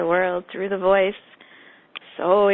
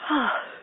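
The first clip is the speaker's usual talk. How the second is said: drawn-out open-mouthed yawn; loud demo yawn; 4 semitones higher than usual. Shown as a demonstration. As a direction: yawn aloud so they catch it. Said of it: "let me yawn again".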